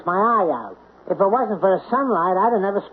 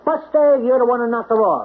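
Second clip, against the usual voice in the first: mock cop voice; 6 semitones higher than usual. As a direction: imitate the cops accusing him. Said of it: "and the cops say".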